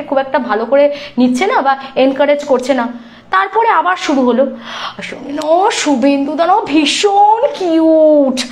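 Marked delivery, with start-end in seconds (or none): 5.0-8.4 slow, drawn-out gushing imitation; mocking gush. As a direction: ham up her fawning over the husband. Mocking the woman gushing over husband. (বরকে নিয়ে ন্যাকামি করা মহিলার টেনে টেনে নকল)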